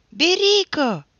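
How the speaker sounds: high loud call of his name; calling out to restrain a friend; shout. A narrator's reading. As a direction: shout to stop him. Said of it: "strigă Nilă".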